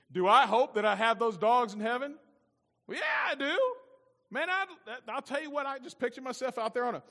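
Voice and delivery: loud, high-pitched enthusiasm; excited outburst. Real, not acted. Pastor admits hoping his dogs reach heaven, excited and high. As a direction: burst out with an eager confession.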